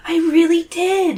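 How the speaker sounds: put-on high, stretched insistent girl voice; Zoe. A narrator's reading. Zoe convincing her teacher, high and insistent. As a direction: insist in a high, slowed squeak. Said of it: "Zoe nearly shouts".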